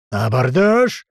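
loud battle yell. Acted. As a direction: roar the boarding call. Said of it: "заорал Леший".

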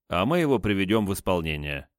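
ordinary delivery, nothing marked

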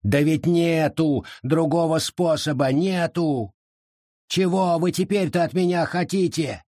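high, pleading exasperation; desperate protest. Acted. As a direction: push back in despair. Forest protests in dismay.

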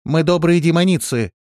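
high, loud declaration; confident. A narrator's reading. Листи reassuring the cellar voice, confident. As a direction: proclaim it proudly and surely. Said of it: "уверенно ответила Листи".

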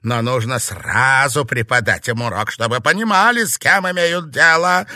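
loud, high, forceful rebuke; harsh witch laying down the law; angry scolding. Acted. Witch angrily scolds her colleague.

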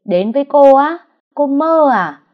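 loud, high scornful yell; contemptuous roar. A narrator's reading. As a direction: shout her down with scorn. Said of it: "rồi gào lên". (gào lên khinh bỉ)

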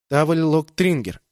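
slow, lightly voiced machine report; ship computer voice. A narrator's reading. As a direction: report it like a ship's AI. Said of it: "сообщил корабль". (голос бортового компьютера)

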